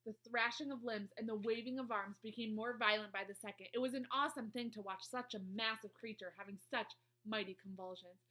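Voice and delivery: plain reading voice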